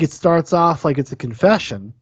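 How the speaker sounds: ordinary delivery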